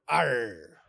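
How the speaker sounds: drawn-out pirate arr; pirate growl. Shown as a demonstration. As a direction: demonstrate a slow pirate arr. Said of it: "get your best pirate voice going".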